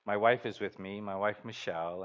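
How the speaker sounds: usual speaking voice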